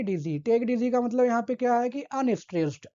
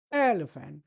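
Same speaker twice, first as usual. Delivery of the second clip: Elephant with ए hit first and the rest dropping away lower; stress on first syllable; 6 semitones lower, slower than usual. Shown as a demonstration. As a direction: punch the ए, let लिफेंट fall. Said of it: "तेज बोलेंगे". (पहले अक्षर ए पर ज़ोर)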